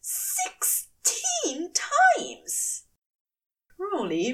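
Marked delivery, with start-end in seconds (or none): 0.0-2.8 very slow, high, stretched outcry; aghast gasp. Acted. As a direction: drag it out, aghast. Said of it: "Aziraphale gasped audibly".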